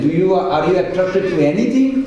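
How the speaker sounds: normal talking voice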